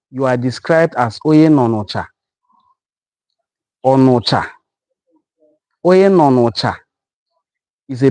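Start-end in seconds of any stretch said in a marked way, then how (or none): none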